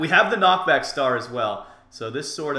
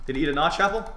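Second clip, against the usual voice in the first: normal talking voice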